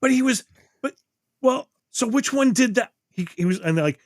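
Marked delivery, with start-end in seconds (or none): 0.0-2.9 put-on much higher, frustrated teen whine; confused, half-hearted mock anger, pitched way up; mock mad teen. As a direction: play the fourteen-year-old getting mad at the plot. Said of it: "he started getting mad".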